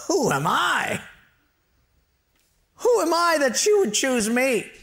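high-pitched, incredulous protest; disbelieving Moses. Acted. Moses answering God's call, high-pitched self-doubt. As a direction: protest like you're the wrong man.

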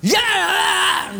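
high, loud hammed-up yell; mock yell. Son taking it out on his sister, mock yell. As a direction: blast out a cartoon yell.

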